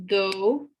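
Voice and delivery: "do" said like the English word do; "do" rhymes with English do; Urdu number two. Shown as a demonstration. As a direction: model "do" crisply, English style. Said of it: "like the English word".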